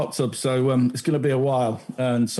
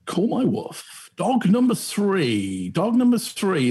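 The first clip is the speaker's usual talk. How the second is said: game show announcer; 4 semitones higher, slower than usual. Acted. Host announcing the quiz round.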